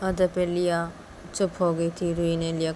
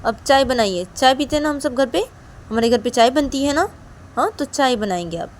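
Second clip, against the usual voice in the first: loud, higher-pitched insisting; pushy, raised-voice argument; insistent demand; 7 semitones higher, 6 dB louder than usual. Acted. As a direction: lay down the rule to mother. Daughter insists mother make only tea.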